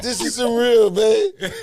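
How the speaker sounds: fighting back tears as it hits him; choked up. Real, not acted. Recalling crying over his father.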